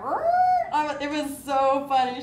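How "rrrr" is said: mock "rrrr" sound effect; imitated recoil noise. Mom imitating daughter halting a cuddle, comic "rrrr". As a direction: act out a sudden pull-away noise. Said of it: "She was just like".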